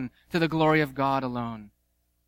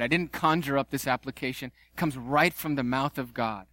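ordinary talk, nothing marked

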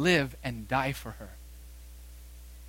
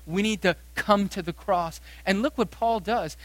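normal talking voice

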